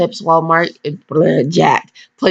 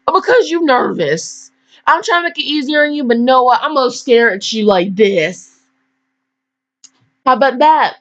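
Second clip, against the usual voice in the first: mock sassy reply; 5 semitones higher than usual. Retold reply to couple.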